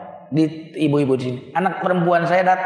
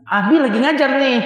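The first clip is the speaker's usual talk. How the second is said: a little higher, brushing the daughter off; impatient father; 7 semitones higher than usual. Retold or imitated. Father telling daughter he is busy, impatient. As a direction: shoo her off mid-class. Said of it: "Kenapa, Bu?".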